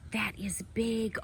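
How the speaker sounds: hushed whisper so as not to startle her; awed whisper to the viewers. Real, not acted. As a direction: breathe it out in a whisper. Whispering beside the eating squirrel.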